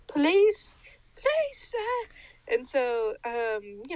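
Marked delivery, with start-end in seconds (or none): 0.1-2.1 put-on high, babyish beg; mock pleading. Begging a boy for the first hit, mock pleading.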